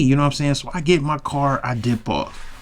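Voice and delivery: usual speaking voice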